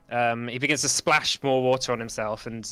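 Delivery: usual speaking voice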